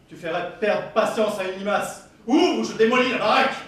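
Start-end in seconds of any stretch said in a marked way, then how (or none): 2.3-3.5 angry threat. Acted. Son threatens to break in.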